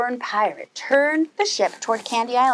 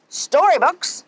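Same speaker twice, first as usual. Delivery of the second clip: higher, raised pirate bellow; pirate finds storybooks instead of gold, bellowing; 6 semitones higher, slower than usual. Acted. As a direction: bellow it in disgust. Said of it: "Pete bellowed".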